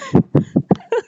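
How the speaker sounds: airy giggle up high; sudden high airy laugh; high breathless laugh. Real, not acted. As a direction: burst into a high voiceless laugh. Laughs at own remark.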